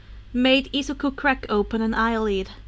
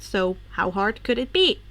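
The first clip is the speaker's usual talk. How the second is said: ordinary delivery, nothing marked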